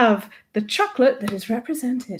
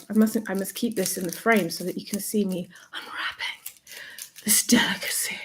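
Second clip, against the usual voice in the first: whispering; 6 dB quieter than usual. Real, not acted. Whispering while unwrapping chocolate.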